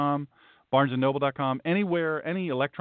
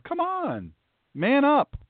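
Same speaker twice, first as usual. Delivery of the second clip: high, emphatic "come on"; exasperated exhortation; 11 semitones higher, much slower than usual. Real, not acted. Pastor chiding believers who take petty disputes outside, exasperated and high. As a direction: push them to toughen up, pitched well up.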